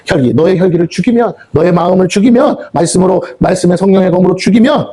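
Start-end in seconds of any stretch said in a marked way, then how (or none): none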